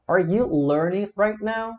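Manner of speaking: "Are you learning right now" slow and high as a question; interrogative intonation. Shown as a demonstration. Teacher modelling for students, "Are you learning right now" in high question tune. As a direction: raise the whole question, unhurried.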